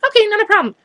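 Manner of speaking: high, loud, breezy mimicry; imitated chirpy can-do reply; imitated helpful colleague. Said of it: "She goes".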